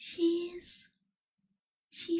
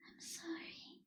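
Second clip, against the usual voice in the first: sighing apology; 13 dB quieter than usual. Acted. Vampire regrets getting excited.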